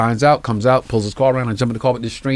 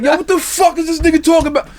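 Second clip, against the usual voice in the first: high, hammed-up heckler voice; mock heckler; faster than usual. Audience mocking the comic's Bill Clinton joke, hammed-up high heckle. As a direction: play a baffled crowd member, pitched way up. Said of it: "and they're like".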